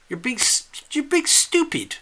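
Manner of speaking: high, sing-song friendly scolding; affectionate chiding. Shown as a demonstration. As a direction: tease a good friend kindly, arm round the shoulder. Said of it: "affectionate, kind way of putting your arm around the shoulder".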